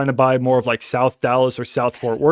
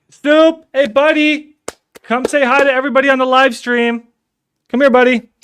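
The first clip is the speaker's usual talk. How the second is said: high, loud sing-song pet talk; calling the dog; 12 semitones higher, 6 dB louder than usual. Real, not acted. Calling his dog onto stream.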